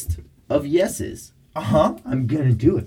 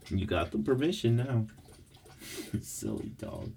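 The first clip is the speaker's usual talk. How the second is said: ordinary talk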